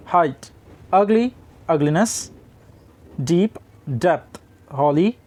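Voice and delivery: slow word-by-word reading of English word pairs; careful pronunciation demo. Shown as a demonstration. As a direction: say each word slowly and clearly for learners. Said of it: "इतने word जिनको मैंने अभी pronounce किया".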